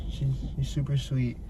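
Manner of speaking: ordinary talk, nothing marked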